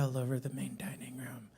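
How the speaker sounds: low, half-whispered warning; discreet hush. Retold or imitated. Manager lies to diners about a mess, discreet hush. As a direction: drop the voice as if sharing delicate news.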